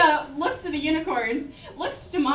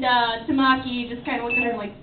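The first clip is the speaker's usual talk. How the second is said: usual speaking voice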